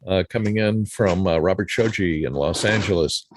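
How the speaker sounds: normal talking voice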